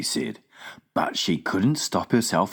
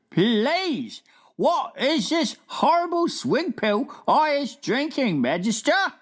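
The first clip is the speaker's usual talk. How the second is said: BFG voice. Acted. Giant asking the Queen at breakfast, wondering. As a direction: puzzle it out like a big gentle giant.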